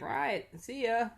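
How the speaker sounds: high, drawn-out comic farewell; mock hasty goodbye. Daughter replies to Mark's threat before driving off, comic high goodbye. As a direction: imitate someone giving in and leaving.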